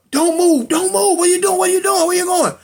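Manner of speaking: high, loud, urgent police yelling; police shouting orders. Retold or imitated. Police closing in on the brother, shouted commands acted out. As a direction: fire off frantic commands on one high pitch. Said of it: "They telling him".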